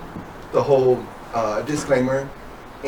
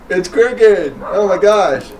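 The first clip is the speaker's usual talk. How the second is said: excited; 7 semitones higher, 7 dB louder, much slower than usual. Real, not acted. Laughing at crooked cigar cut.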